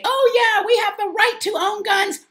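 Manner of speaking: very high, loud mock voice; mocking gun owners. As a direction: squeal it in mockery of gun defenders. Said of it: "we sit here and say".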